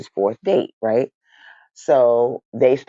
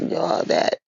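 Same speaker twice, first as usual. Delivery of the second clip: wheezing laughter; 5 semitones higher, 5 dB quieter than usual. Real, not acted. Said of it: "I'm literally crying".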